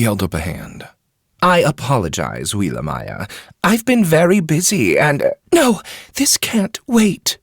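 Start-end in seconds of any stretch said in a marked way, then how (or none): 1.4-7.4 a busy man dodging a conversation; Ketsu, hurried. A narrator's reading. Ketsu brushing her off.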